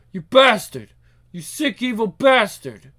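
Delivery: loud, anguished outburst; furious cursing. Acted. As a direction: curse the killer in grief. Grieving father cursing killer.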